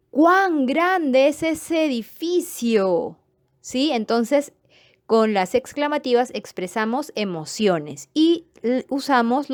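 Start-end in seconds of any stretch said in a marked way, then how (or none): 0.1-3.2 slow, high and awed; amazed exclamation. Shown as a demonstration. Teacher demonstrating an exclamation of wonder, slow and awed. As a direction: marvel at the building, stretching every word. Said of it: "el hablante pretende expresar una emoción".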